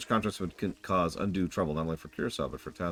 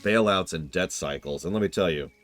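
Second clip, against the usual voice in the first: usual speaking voice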